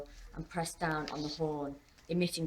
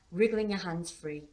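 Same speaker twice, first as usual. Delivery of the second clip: plain reading voice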